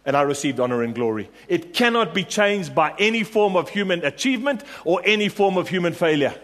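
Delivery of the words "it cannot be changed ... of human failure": higher, emphatic preacher's declaration; impassioned preaching. Real, not acted. Preacher assuring church that honor cannot change, impassioned. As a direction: declare it with firm fervour.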